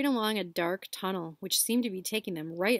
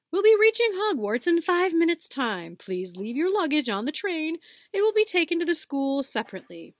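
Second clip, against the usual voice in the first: higher, louder train announcer; echoing announcement; 9 semitones higher, 6 dB louder than usual. Acted. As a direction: announce over the whole train. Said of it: "A voice echoed through the train".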